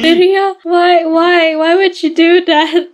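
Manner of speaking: high-pitched mock dismay; high, pretend-horrified questioning; appalled doll character. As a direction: plead in a high toy voice. Doll appalled at soggy cookie.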